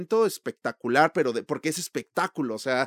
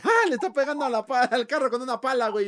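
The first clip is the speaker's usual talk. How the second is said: mock dumb moviegoer, higher and amused; laughing moviegoer; 8 semitones higher than usual. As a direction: imitate a laughing moviegoer, pitched up. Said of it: "Y la gente".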